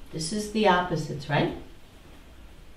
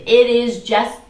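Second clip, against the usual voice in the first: mock certainty; 5 semitones higher, 8 dB louder, slower than usual. Shown as a demonstration. Mimicking certainty.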